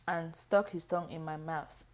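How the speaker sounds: plain reading voice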